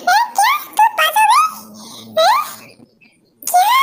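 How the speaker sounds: high startled questions; can't believe her ears; surprised. Acted. Sister hears the result, startled and high.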